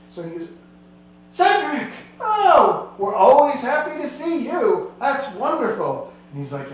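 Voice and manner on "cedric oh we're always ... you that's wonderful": high, loud, gushing greeting; delighted welcome. Acted. Innkeeper greeting an old friend with a high, loud, gushing welcome. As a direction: throw your arms open with a bright cry of his name.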